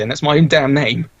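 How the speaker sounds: ordinary talk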